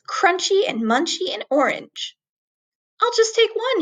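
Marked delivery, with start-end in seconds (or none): 3.0-3.8 high eager little squeak; eager mouse. Acted. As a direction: reach for it greedily. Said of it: "he thought".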